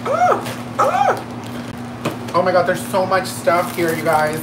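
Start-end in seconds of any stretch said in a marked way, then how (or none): 0.0-2.3 high excited squeal. Real, not acted. Squeal over makeup package.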